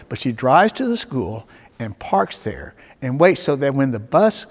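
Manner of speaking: normal talking voice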